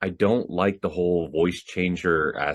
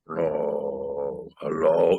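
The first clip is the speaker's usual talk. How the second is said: low, drawn-out slow-motion voice; dark voice changer; 4 semitones lower, much slower than usual. Shown as a demonstration. Mocking a cheap voice changer, dark slow-motion voice. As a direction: imitate a dark voice-changer setting. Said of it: "And dark is like".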